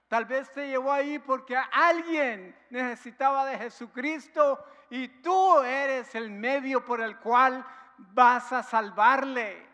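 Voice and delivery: the pastor preaches to his congregation with rising force; fervent preaching. Acted. Pastor drives home God's purpose to the church, fervent preaching.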